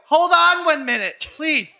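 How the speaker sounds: high-pitched, put-on exasperated mother; hammed-up high mom voice; impression of his mom. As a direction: play a mom calling out to be left alone. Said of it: "your impression of your mom".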